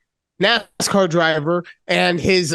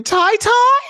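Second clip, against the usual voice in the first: put-on squeaky high baby talk; babyish high mock; 4 dB louder than usual. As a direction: pitch it way up, teasing. Mocking babyish word.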